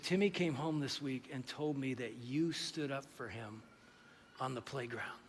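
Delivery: very soft, low, halting account; retold tearful gratitude, hushed; tearful mom. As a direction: quietly voice a mother holding back tears. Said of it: "And she starts to cry".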